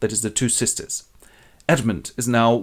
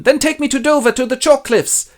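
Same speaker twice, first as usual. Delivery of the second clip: high, louder plea; pleading Gloucester; 14 semitones higher, 7 dB louder than usual. Acted. Blind old man begs his son to take him, high plea. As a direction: ask to be led, pitched up.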